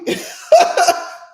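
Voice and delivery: airy unvoiced laugh; breathy real laugh. Real, not acted. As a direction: laugh through the line. Laughs mid quote.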